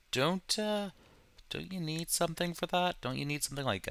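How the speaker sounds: high, hesitant questioner; imagined viewer asking. Acted. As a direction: voice a viewer piping up with a doubt. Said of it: "I can already hear people asking me".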